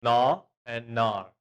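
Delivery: "Na and nar" said very slowly, "nar" with a sharp falling fourth tone; tone contrast demo. Shown as a demonstration. Teacher slowly contrasts "Na" and "nar" for students. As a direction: draw out "Na and nar", dropping hard on "nar". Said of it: "The four tone".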